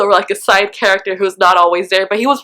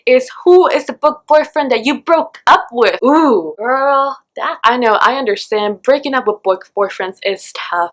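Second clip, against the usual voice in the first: usual speaking voice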